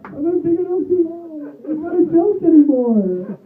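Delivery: put-on a worried young man asking his roommate; anxious plea. His own anxious quote.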